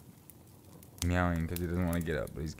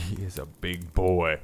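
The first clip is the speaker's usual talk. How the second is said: fond slow laugh through the words; laughing admiration; 5 dB louder, slower than usual. Real, not acted. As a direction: savour it with a laugh. Admiring the big cat.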